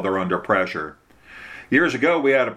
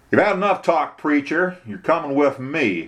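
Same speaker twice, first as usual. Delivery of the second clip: higher-pitched, impatient sheriff; insistent; 6 semitones higher than usual. A narrator's reading. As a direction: order the prisoner along, voice raised in pitch. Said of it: "Sheriff Pinkerman insisted".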